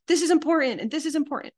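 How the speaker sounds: high, loud and quick repeated insisting; mock insistent. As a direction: hammer the point home, half-mocking. Mock insistent partner quote.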